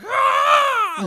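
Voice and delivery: loud high Kamehameha yell; mock battle yell. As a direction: yell like an anime hero firing a blast. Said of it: "he's like".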